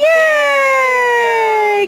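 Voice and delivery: high, loud happy cheer; excited cheer. Real, not acted. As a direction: cheer the trick. Cheering the dog's trick.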